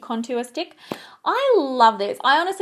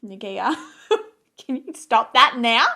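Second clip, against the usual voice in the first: scolding herself through a laugh; half-laughing self-scold; 7 dB louder than usual. Retold or imitated. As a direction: tell yourself off with a giggle. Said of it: "and then I'm like".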